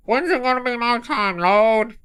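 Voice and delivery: high, muffled whine through a hand; muffled Bill voice. Acted. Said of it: "Your Bill voice, muffled voice".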